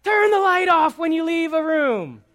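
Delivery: loud, high, exasperated yell. Acted. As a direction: holler like a frustrated parent. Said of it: "all of a sudden our voice is raised".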